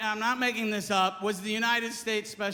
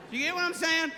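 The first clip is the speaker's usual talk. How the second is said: usual speaking voice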